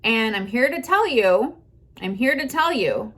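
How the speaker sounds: normal talking voice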